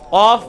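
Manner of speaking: قاف loud and heavy from the back of the tongue; deep qaaf. Shown as a demonstration. Teacher leading class practice, loud deep qaaf. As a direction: drill قاف, back tongue against palate, held long. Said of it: "ابھی آپ practice کریں".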